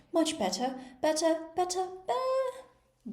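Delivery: queen's voice turning into a sheep's bleat; rising into a squeak. A narrator's reading. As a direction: let each "better" climb until it bleats. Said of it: "her voice rising into a squeak".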